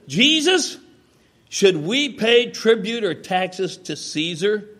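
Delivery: high, loud, drawn-out testing question; pointed challenge. Retold or imitated. Questioners try to trap Jesus on taxes, pointed challenge. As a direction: call out the name, then spell out the question slowly.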